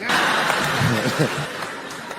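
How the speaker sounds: breathy imitated laugh that rises; mock laugh. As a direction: imitate his nervous laugh while running. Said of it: "He was like".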